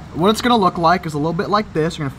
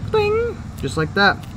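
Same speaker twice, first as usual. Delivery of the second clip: squeaky bing; slower than usual. Pretend. Sound effect for the cut.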